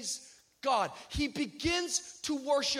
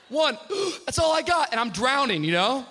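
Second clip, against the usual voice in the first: gasping panic; 3 semitones higher, 10 dB louder than usual. Retold or imitated. Reliving drowning while hanging.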